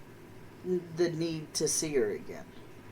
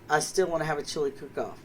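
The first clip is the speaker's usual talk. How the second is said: ordinary talk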